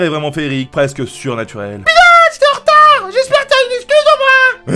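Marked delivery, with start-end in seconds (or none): 1.8-4.8 very high, loud squeaky friend; scolding Sarah. Acted. Sarah scolding the late heroine, loud high. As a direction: scold like a shrill best friend.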